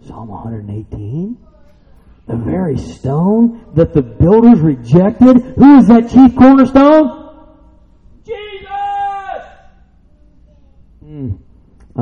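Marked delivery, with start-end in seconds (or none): 2.3-7.3 slow build to a high, loud question; building preacher's question. Real, not acted. 8.3-9.4 high, drawn-out cry from the pulpit; shouted answer. Real, not acted.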